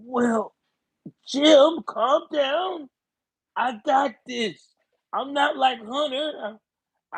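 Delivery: mock old Joe Biden reassuring. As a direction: pat his friend on the back, hammed-up Biden. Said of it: "you know how Biden respond".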